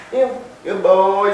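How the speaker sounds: usual speaking voice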